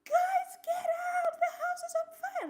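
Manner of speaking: urgent shout. Retold or imitated. Sister in another room ordering everyone out, shouting high and loud. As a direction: bellow at everyone to get out.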